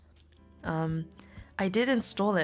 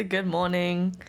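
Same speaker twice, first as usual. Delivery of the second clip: ordinary talk, nothing marked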